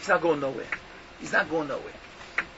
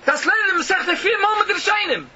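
higher, louder scolding; indignant rabbi's retold rebuke; retold yell; 10 semitones higher, 5 dB louder than usual. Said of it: "But did he yell at me".

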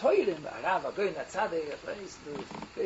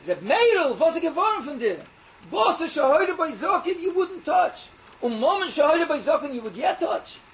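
raised, louder, higher pitched wife's rebuke; scolding rebbetzin; 8 semitones higher, 6 dB louder than usual. Retold or imitated. Wife scolds Reb Meirel over the money, loud and high. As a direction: rebbetzin pressing her husband, voice up and loud.

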